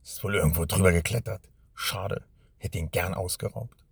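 disappointed robber grumbling quietly. A narrator's reading. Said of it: "murrte er".

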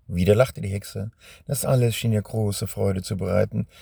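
plain reading voice

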